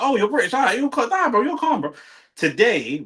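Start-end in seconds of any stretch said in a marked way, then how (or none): 0.0-1.9 much higher, louder, easygoing approval; officer relaxing on hearing a British accent; impressed officer. Retold or imitated. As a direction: play the officer waving you through, impressed. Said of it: "they're like".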